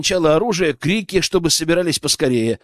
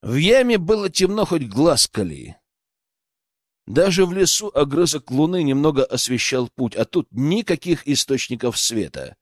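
ordinary delivery, nothing marked

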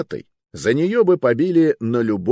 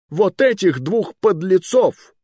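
high, loud, slow command; angry order; 11 semitones higher, 5 dB louder, slower than usual. Acted. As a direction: point and condemn them. King orders arrest.

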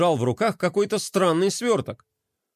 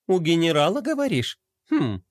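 thoughtful. A narrator's reading. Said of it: "Глория задумалась".

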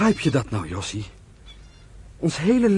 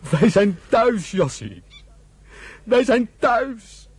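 slow, rising, higher cry of arrival; overcome with joy; 7 semitones higher, much slower than usual. Acted. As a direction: let it well up, much higher the second time. Brothers reach the promised land. (ontroerd en uitbundig, hoger en langzaam)

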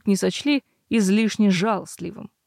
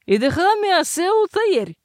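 irritated; 9 semitones higher, 4 dB louder than usual. A narrator's reading. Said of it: "прогудела кружка чуть более раздражённо".

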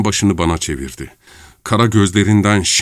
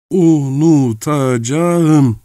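drawn out slowly, raised and louder; slow resolute vow; 7 semitones higher, 4 dB louder, much slower than usual. Acted. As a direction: swear it slowly to herself.

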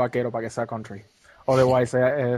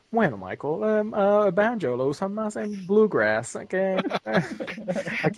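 higher, slower know-it-all nerd voice; 6 semitones higher, slower than usual. Pretend. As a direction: lecture like a nerd, breaking into laughter. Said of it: "con mi voz de nerd".

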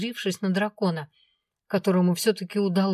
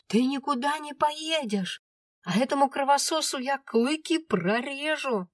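forbidding dragon; 8 semitones higher than usual. A narrator's reading. Dragon husband forbidding the trip.

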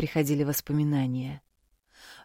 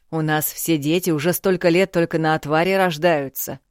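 demanding father; 2 semitones higher, 7 dB louder than usual. Acted. Father interrogates daughter.

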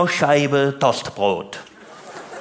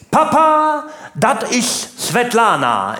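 proud son; 7 semitones higher, slower than usual. Acted. Son presenting his Czech model girlfriend to Papa, proud announcement. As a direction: call it out proudly, pitched up.

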